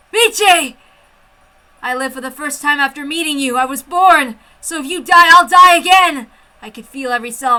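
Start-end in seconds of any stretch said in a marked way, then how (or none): 0.1-0.8 high, loud shout of her name; yelling a loved name across the base; calling out. Acted. 3.6-6.3 building high and loud; rising emotional declaration; impassioned cry. Acted. Impassioned vow.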